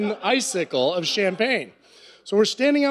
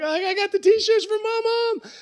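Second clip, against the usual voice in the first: put-on much higher, sloppy drunk boast; 13 semitones higher than usual. Drunk narrator brags to his friend Brad about the t-shirts, sloppy drunk voice. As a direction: play it proud and plastered. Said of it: "I'm all drunk".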